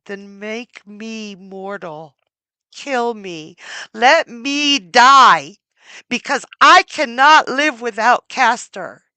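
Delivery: slow, loud cry to be let die; rising from hush to a howl; begging for death in grief; anguished plea. Acted. Pollux asks to die.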